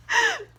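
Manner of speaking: high burst of laughter; loud laugh. Real, not acted. Laughs at stupid book line.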